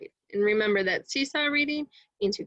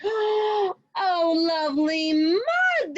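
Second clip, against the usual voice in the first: high, drawn-out delighted oh; delighted cry; 9 semitones higher, 3 dB louder, slower than usual. Acted. The animals shout their joy at the mud again, high and slow. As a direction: savour the mud with glee.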